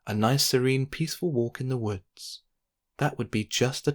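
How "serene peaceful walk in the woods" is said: usual speaking voice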